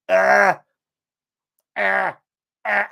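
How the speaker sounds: repeated Dundee "Ah", trying to nail it; Dundee accent. Shown as a demonstration. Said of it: "I can't quite get it right".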